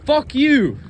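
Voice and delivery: loud, high-pitched curse; frustrated outburst. Real, not acted. Kid cursing the adults who never wanted answers, frustrated outburst. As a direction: burst out with real frustration, voice jumping up. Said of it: "it's, it's frustrating".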